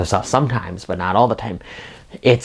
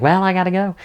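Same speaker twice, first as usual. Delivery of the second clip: much higher, bright sitcom exit line; a hammed-up sitcom goodbye; sitcom chirp; 10 semitones higher, faster than usual. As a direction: switch on the cheery sitcom mom. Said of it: "her sitcom-y demeanor is like".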